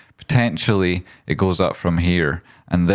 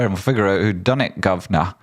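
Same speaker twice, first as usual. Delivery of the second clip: ordinary delivery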